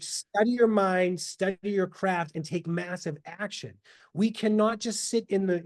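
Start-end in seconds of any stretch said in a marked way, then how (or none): 0.0-3.7 slow emphatic. Shown as a demonstration. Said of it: "emphatic slow instruction".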